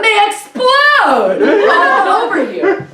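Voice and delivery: normal talking voice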